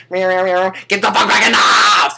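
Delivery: high, loud, put-on creature voice shouting; silly cicada screaming to get back inside; yelling cicada. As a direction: screech at them like an angry bug.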